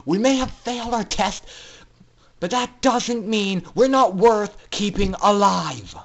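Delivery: angry defiant outburst; angry shout. Acted. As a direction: shout back, defending her dignity. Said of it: "Scootaloo shouted in a flash of rage".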